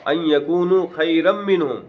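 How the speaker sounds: slow melodic Quran recitation; measured Qur'anic chant; Quranic recitation. Shown as a demonstration. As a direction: draw the Arabic out slowly, chanting. Quranic verse recited in class.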